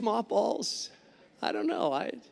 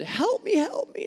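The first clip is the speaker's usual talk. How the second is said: much higher mock cry for help; imitating a panicked plea; 12 semitones higher than usual. Preacher imitating his terrified wife begging, high mock panic. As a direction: play the wife squealing in fright. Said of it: "started going hysterical".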